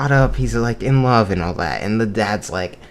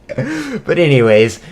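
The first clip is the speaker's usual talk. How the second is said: loud, high, drawn-out laughing words; 5 semitones higher, 7 dB louder, slower than usual. Real, not acted. Teller moves on after the dad's story, laughing. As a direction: pull back to the story through laughter.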